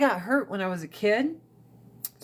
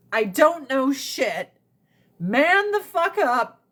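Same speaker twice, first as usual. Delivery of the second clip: slow, higher mock parent lines; drawn-out mocking recitation; slow mocking quote; 4 semitones higher than usual. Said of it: "I was like".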